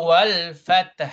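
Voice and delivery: slow "wal fath" with both final consonants sounded; "wal fath" finishing on crisp T and breathy H; clear double sukun. Shown as a demonstration. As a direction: draw out "wal", then articulate the end of "fath". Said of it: "It must be quite clear".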